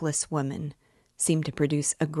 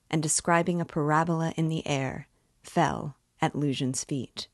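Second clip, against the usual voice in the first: plain reading voice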